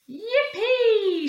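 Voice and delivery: high, loud whoop of joy; old lady shrieking yippee with joy; joyful shout. Acted. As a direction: shout it out with glee, far up. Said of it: "shouted the old lady with joy".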